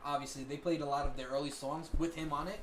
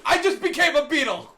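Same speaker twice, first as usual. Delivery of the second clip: smug claim; 10 semitones higher, 11 dB louder than usual. Retold or imitated. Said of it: "I was like".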